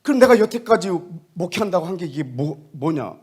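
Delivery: loud, high, indignant question; angry outburst. Retold or imitated. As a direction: demand an answer in hurt anger. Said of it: "뭐 화가 났어요". (화가 나 따지듯 외치며)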